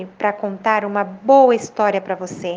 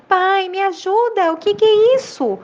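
put-on high-pitched, mildly pleading child; a touch of tearful appeal, voice raised in pitch; tearful plea; 8 semitones higher than usual. A narrator's reading. Tearful boy asking his father for help, high plea. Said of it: "com os olhos cheios de lágrimas".

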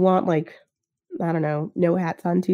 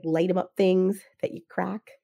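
ordinary delivery

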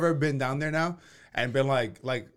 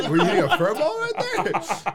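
higher, laughing through the question; giggling jab at a friend; laughing tease; 7 semitones higher, 4 dB louder than usual. Real, not acted. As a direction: crack up while teasing him. Teasing friend mid-laugh.

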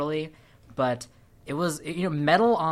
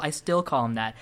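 ordinary talk, nothing marked

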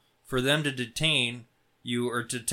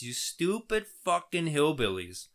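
normal talking voice

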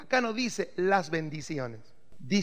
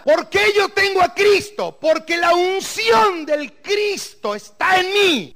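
preaching; 11 semitones higher, 8 dB louder than usual. Acted. Pastor preaches at full force.

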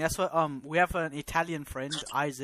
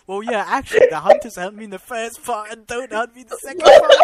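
laughing imitation; 6 semitones higher, 14 dB louder than usual. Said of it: "He just said".

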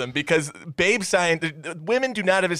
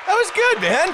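much higher, loud praise; excited; 3 dB louder than usual. Real, not acted. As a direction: cheer him on.

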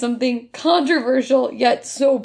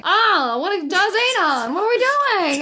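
higher, slow mock old Hollywood transatlantic accent; 7 semitones higher, slower than usual. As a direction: imitate the mom's fake transatlantic lilt. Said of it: "like a Lulu Diamond's, like, old Hollywood".

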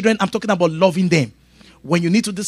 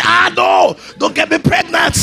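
mock girl's shriek; 11 semitones higher than usual. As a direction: squeal it like a scared girl. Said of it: "you say".

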